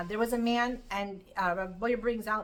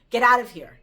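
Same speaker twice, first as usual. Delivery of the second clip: loud, curt mock dismissal; retold insulting dismissal; 12 dB louder than usual. Retelling how somebody insulted the sun, loudly dismissive. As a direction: imitate someone loudly throwing a person out. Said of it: "the sun was insulted by somebody".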